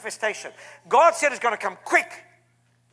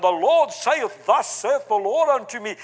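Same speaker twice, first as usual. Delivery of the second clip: Elizabethan English; 4 dB louder than usual. Retold or imitated. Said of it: "we have Elizabethan English".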